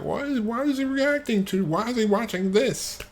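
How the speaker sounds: high, quizzical imitation of a viewer; mock puzzled viewer. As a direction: play a baffled fan asking why. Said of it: "You can be like".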